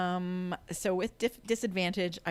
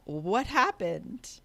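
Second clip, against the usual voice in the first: calling out; 4 semitones higher, 7 dB louder, much slower than usual. Acted. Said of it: "I'm going to ask Linnell".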